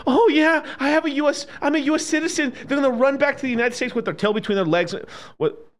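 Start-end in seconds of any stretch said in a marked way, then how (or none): 0.1-2.5 high-pitched mocking expat quote; mock panicked expat. Streamer mocking expats fleeing war, high mock-panicked voice. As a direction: mimic an expat pleading citizenship, pitched way up.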